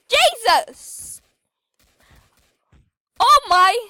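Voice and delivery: loud, high exclamations; startled outburst. Real, not acted. Player caught out by zombies, startled. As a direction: burst out as if suddenly startled.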